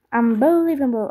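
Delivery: imitating the game's English announcer on Unbelievable; Greek-accented mock announcer Unbelievable. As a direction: say Unbelievable like a hyped game voice, stretched. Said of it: "η προφορά μου είναι τέλεια".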